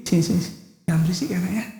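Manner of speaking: near-whispered demonstration of a quiet reprimand; gentle hushed reproof. Shown as a demonstration. Parent calling child over to correct them, hushed and quiet. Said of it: "Ah, pelan".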